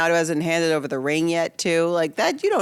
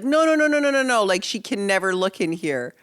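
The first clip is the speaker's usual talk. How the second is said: panicked refusal. Acted. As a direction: act out a frantic string of objections. Acting his panicked refusal to show room.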